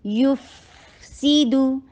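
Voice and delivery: یف سی دو recited slowly, seen joined on; slow tajweed demo; joined recitation. Shown as a demonstration. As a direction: flow یف سی دو together smoothly. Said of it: "سین میں وصل کی sound دیجیے".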